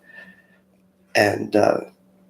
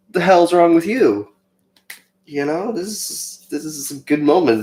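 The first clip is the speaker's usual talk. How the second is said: higher, irritated disbelief; annoyed inner thought; 8 semitones higher than usual. Retold or imitated. Annoyed at the crying girl during adoration, raised and irritated. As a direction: scoff at her under your breath. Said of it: "And I was like".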